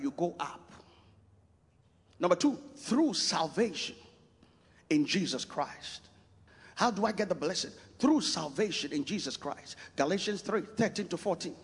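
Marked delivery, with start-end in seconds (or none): none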